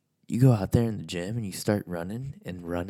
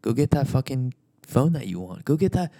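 ordinary delivery

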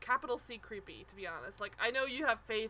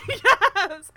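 loud laughing burst of agreement; laughing yes; 10 semitones higher, 18 dB louder than usual. Real, not acted. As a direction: crack up and shout yes. Excited agreement over a classic line.

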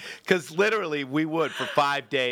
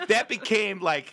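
ordinary talk, nothing marked

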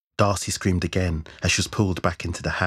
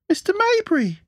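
very high-pitched, louder cry of a name; calling out; 5 dB louder than usual. A narrator's reading. Someone calling to fallen Hal, high-pitched cry. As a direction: call it out high and loud.

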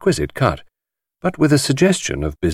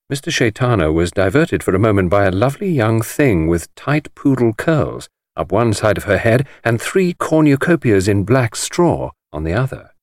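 usual speaking voice

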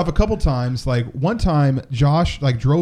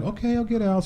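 softer, higher, casual compliance; retold nonchalance; 7 semitones higher, 6 dB quieter than usual. Complying with police orders, mock nonchalant. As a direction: lightly act unbothered while giving in. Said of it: "getting out all nonchalantly".

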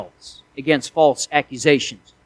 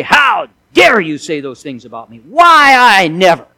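loud offended outburst, slowing on the last words; indignant tirade; 5 dB louder than usual. Pretend. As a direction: shout it as the wronged man who hears lies. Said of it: "and start into a tirade".

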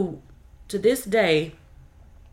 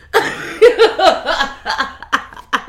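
incredulous laugh; 7 semitones higher, 8 dB louder than usual. Real, not acted. Laughs after outburst.

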